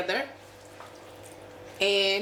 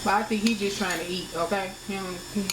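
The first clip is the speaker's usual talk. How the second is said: normal talking voice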